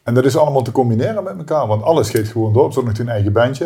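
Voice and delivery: ordinary delivery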